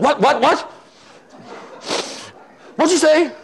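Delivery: high, agitated retort; high-pitched mock outrage; fired up. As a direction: act out someone riled and demanding. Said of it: "kind of fired up".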